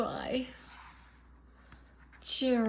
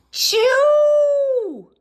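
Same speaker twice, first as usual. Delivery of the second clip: very high, very loud cartoon sneeze; huge sneeze; 15 dB louder than usual. Gerald the elephant sneezes hugely. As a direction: blast out a giant sneeze.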